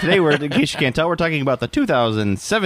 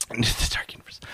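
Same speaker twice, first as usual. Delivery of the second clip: ominous whisper; 7 dB quieter than usual. Pretend. Spooky echo of studio name.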